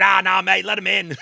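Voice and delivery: put-on loud, half-hearted Australian accent on a British friend; bad Australian accent. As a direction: call it out in a botched Australian accent. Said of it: "That was Australian or not even anything".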